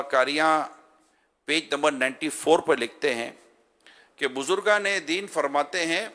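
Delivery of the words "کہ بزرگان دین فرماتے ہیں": usual speaking voice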